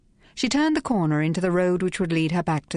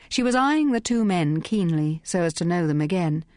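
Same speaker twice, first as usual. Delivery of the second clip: ordinary delivery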